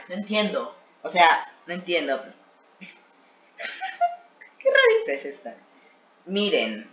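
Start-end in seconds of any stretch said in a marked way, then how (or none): 3.6-4.1 squeaky high giggle; high squeal laugh. Real, not acted. Laughing at old comic. 4.6-5.6 high, disbelieving squeak; incredulous. Real, not acted. Mocking his old comic.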